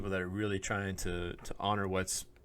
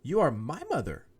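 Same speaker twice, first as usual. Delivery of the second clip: mock indignant; 7 semitones higher than usual. Son protesting to his mother, mildly mock indignant. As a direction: replay the outraged son, half-hearted.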